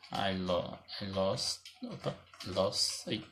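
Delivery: slow, soft, every word drawn out; slow dictation. Shown as a demonstration. Teacher writing the example on the board, slow soft dictation. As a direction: read it out slowly as you write it.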